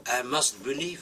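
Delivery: usual speaking voice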